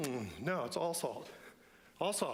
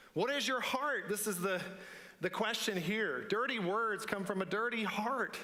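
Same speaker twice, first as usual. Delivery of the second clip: higher, ringing preacher's voice; fervent sermon delivery, raised pitch; impassioned preaching; 7 semitones higher than usual. Acted. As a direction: drive each line home from the pulpit. Preaching builds to full force.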